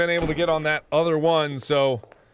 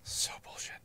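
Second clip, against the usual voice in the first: very soft, half-whispered grumbling; quietly pissed off; 11 semitones lower, 15 dB quieter than usual. Real, not acted. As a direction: seethe under your breath. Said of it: "I'm so pissed".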